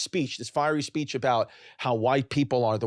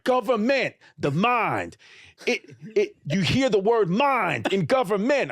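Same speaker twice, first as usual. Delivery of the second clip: mock street-preacher rant about government; ranting train preacher; 7 semitones higher than usual. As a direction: ham up the crazed subway preacher. Imitating ranting man on train.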